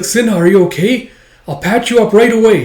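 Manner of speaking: high, loud, anxious voice; worried calling. Acted. Yun calls to wounded friend Sinha, worried.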